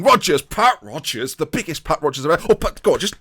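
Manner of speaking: loud, excited shout of the scorer's name; goal celebration. Real, not acted. Manager cheering his striker's goal, excited shout. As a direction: yell it as the ball hits the net.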